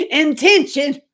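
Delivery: laughing through the word. Real, not acted. Explaining the spicy link while laughing, high.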